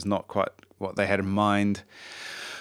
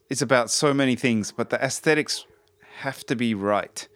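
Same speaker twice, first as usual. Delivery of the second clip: ordinary talk, nothing marked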